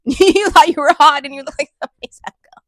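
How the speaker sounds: loud laughing roast; laughing loud jab. Real, not acted. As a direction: cackle out the mockery.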